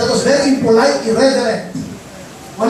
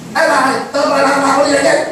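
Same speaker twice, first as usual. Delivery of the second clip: fast, mock-annoyed snap back; a touch of cheeky rudeness; lightly voiced sassy sibling; bratty retort; 2 semitones higher, much faster than usual. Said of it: "mabubwisit ka?".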